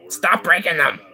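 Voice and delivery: high, sharp outburst; sudden exasperated shout; exasperated yell. Real, not acted.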